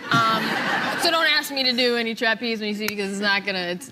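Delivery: laughing through the words; chuckling while joking about herself. Real, not acted. Self-deprecating joke, laughing through.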